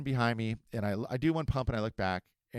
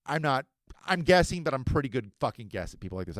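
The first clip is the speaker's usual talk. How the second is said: ordinary talk, nothing marked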